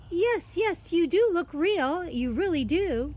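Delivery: put-on high, loud, eager girl's voice; placating girl. Girl placates the offended cat, eager. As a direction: reassure eagerly at high pitch.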